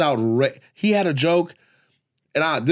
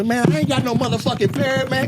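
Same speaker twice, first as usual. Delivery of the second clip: higher put-on crackhead voice, insisting; crackhead denying; 11 semitones higher than usual. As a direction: play a lying crackhead caught red-handed.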